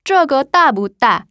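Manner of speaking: 这个大不大 slow, with tones sharply marked; 这个大不大 spaced syllable by syllable; Mandarin model sentence. Shown as a demonstration. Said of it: "따는 크다란 뜻이에요".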